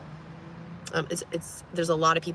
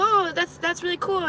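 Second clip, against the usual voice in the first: overly sweet reply; 10 semitones higher than usual. Retold or imitated. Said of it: "I go".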